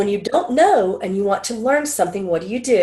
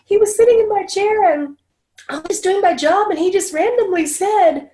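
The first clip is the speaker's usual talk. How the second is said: moved stylist; 12 semitones higher, 4 dB louder than usual. Retold or imitated. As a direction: lift way up, as someone overwhelmed. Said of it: "and she goes".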